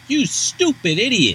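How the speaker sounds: hammed-up exasperated girl scolding him; mock scolding. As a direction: sneer it out slowly. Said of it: "she's like".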